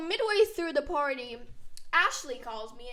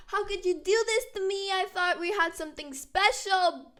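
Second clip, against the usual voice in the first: mock scream; 6 semitones higher, 5 dB louder than usual. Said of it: "while screaming".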